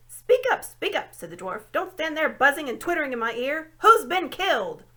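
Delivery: loud, high dwarf shouting for the owl to speak up; impatient deaf dwarf. A narrator's reading.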